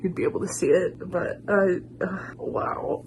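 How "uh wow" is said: breathless whispered "wow"; awed whisper. Real, not acted. Overwhelmed by the song, a real whisper. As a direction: let the awe steal your voice. Moved by the song's bridge.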